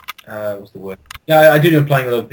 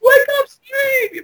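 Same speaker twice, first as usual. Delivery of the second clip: long, high call to wake him; high drawn-out wake-up call; much slower than usual. Real, not acted. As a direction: drag it out, very high, to rouse a sleeper. Shouting into friend's voicemail.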